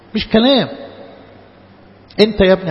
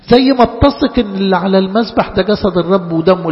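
usual speaking voice

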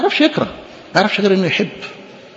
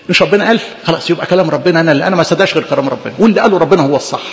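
normal talking voice

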